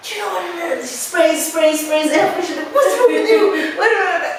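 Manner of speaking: mock the guy barking about the smell; imitating a shouting man. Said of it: "Why are you shouting at me?".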